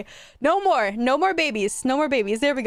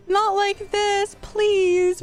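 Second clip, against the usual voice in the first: pleading; 7 semitones higher, much slower than usual. Real, not acted. Begging as pet dies.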